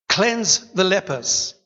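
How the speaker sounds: each word slowed and weighed; slow emphatic. Shown as a demonstration. Preacher stressing the commission, slow and weighty. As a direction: lay out the commands slowly. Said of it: "repeats command".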